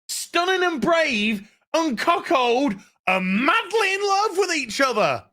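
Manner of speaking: high-pitched, drawn-out exasperation; rising, theatrical outrage; incredulous outburst. Real, not acted. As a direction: stretch the words out in disbelief. Incredulous rant about sudden romance.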